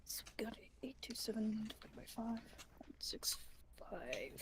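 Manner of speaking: breathy whisper, numbers under the breath. Real, not acted. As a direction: whisper the sums to yourself.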